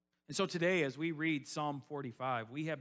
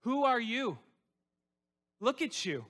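loud, higher taunting accuser voice; taunting Satan; 8 semitones higher, 6 dB louder than usual. Retold or imitated. Pastor voices Satan mocking the church, loud taunting. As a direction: sneer down at them like the devil. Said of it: "Satan is trying to push you down and saying".

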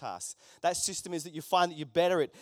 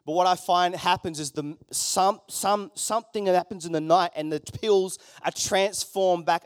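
normal talking voice

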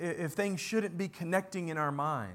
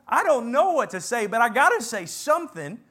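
louder and higher, words tumbling out; the rush of someone who must say something; flustered blurt; 5 semitones higher, 9 dB louder than usual. Retold or imitated. Peter overwhelmed by the vision, blurting loudly.

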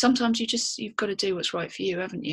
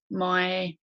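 normal talking voice

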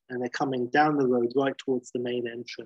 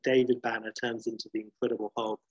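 plain reading voice

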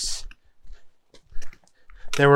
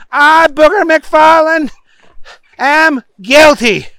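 oath-taking Booger; 12 semitones higher, 7 dB louder than usual. A narrator's reading. Booger parroting the judge, loud and overblown. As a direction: boom out every word at high pitch. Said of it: "said Booger".

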